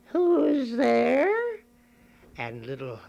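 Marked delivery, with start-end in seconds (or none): 0.1-1.3 put-on high, loud old lady call; sick grandmother. Grandmother calling from her sickbed, high old lady voice. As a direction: call out like a frail granny in bed. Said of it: "and said".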